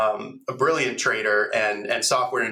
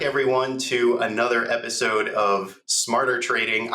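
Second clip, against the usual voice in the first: normal talking voice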